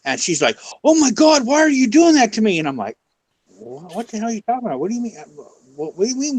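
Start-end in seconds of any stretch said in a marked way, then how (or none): 0.8-2.6 very high mock-panicked outcry; neighbor tripping out. Neighbor blaming her for the spirit voices, imitated freak-out. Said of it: "she starts tripping out". 3.6-6.4 retold bewilderment. Retold bewildered answer to accusing neighbor.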